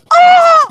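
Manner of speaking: sudden high loud Oh of shock; mock shocked gasp. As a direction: imitate a shocked emoji face. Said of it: "an emoji going".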